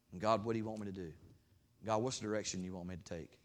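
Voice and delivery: ordinary talk, nothing marked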